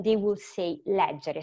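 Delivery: normal talking voice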